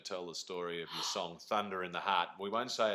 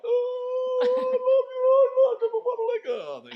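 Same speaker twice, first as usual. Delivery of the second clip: mock squealing, much higher and louder; starstruck fan; 16 dB louder than usual. Spoofing a Klingon fan meeting an idol, high hysterical squeal. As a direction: gush hysterically like a clingy fan.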